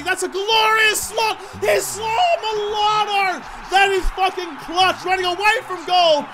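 high, loud, drawn-out cry of joy; calling the goal like a thrilled fan; excited goal call. Real, not acted. Gamer cheering his teammate's goal, excited shout.

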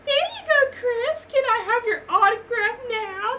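very high, loud lady voice; gushing lady. A narrator's reading. Lady asking her idol for an autograph, gushing squeal. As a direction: plead in a high fangirl squeal. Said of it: "the lady asked".

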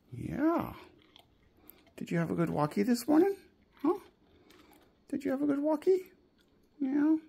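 talking to a dog. Real, not acted. Asking dog about walk.

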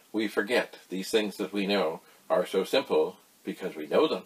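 usual speaking voice

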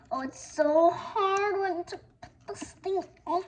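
usual speaking voice

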